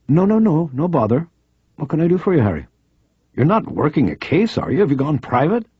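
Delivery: a keen journalist pressing a friend; eager female reporter. Acted. Reporter urging Harry to stay on the phone, insistent.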